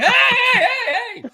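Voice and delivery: high, loud mock-panicked yelps; acting out frantic cries for help; panicked calling. Skier caught bent in half by the chairlift, mock panic.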